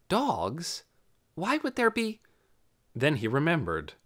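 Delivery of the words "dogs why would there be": baffled. A narrator's reading. Dune baffled by barking.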